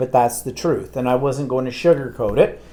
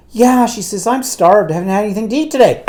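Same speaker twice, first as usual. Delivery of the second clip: mock eager mother; 8 semitones higher, 4 dB louder than usual. Mother's eager reply retold.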